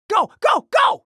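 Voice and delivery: high, rising shouts urging them on; urgent rallying. Acted. Urging the team on.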